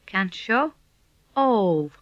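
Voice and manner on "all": "All" as one long, held O sound; long vowel. Shown as a demonstration. Teacher models the long O for a learner, drawn-out "All". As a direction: stretch the O in "All". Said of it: "long version of this".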